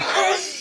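high, soft wheezing gasp; gasping for breath. Retold or imitated. Winded wrestler fallen inside the ring, acted gasping. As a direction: mime sucking air with empty lungs. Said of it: "trying to catch my breath".

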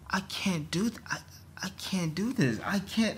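despairing whisper. Real, not acted. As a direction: breathe it out, defeated.

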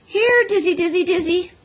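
very high, loud dog call. A narrator's reading. Rival boy calls the dog.

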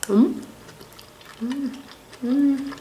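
chewing while humming; humming through closed lips; mouth full. Real, not acted. Seen 2: Fork enters mouth at 0.1, then closed-mouth chewing throughout; food unclear.

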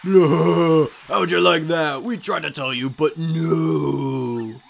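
booming showman announcer, drawn out; loud ring announcer. Acted. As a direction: ham it up, gloating over the losers. Game announcer read in character.

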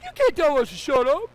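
high, loud outrage; indignant shout. Retold or imitated. Scolded adults to child, indignant shout. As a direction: fire back at the upstart.

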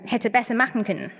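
ordinary talk

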